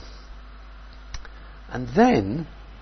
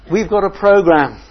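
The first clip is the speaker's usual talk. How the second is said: higher, louder, impatient; busy disciples; 7 semitones higher, 8 dB louder than usual. Retold or imitated. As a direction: insist on the schedule. Disciples could have said.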